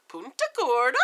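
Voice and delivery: Punta Gorda in a playful put-on accent. Shown as a demonstration. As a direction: say the place name with a relished, lilting accent. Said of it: "that has an accent coming out of my mouth".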